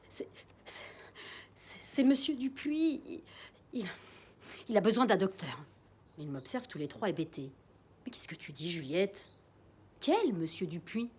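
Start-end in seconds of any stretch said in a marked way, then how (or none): 0.1-5.6 stuttering, out of breath; breathless stammer. Acted. Breathless girl stammering to her parents about the neighbour. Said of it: "à bout de souffle, je bégais". 8.0-11.1 bewildered hush. Acted. Mother bewildered, whispering.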